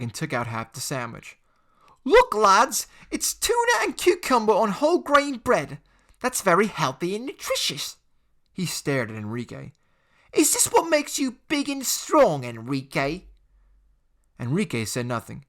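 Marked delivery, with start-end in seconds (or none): 2.0-8.0 put-on high, loud sneering teen bully; a jeering schoolboy voice; mocking bully. Bully Jake mocking Enrique. 10.3-13.2 put-on high, loud sneering teen bully; a jeering schoolboy voice; mocking bully. Jake taunting Enrique.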